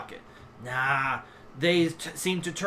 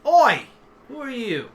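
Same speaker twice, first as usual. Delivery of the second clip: challenging pawn; 8 semitones higher, 7 dB louder than usual. Acted. Sewer pawn challenging a stranger, calling out. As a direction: call out a challenge to an intruder.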